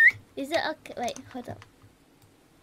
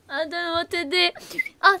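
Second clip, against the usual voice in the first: usual speaking voice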